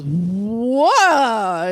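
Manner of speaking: long, swooping mock-scared wail; hammed-up stunned cry that swells; speechless little boy. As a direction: imitate a boy struck dumb, stretching the sound. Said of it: "can't even talk".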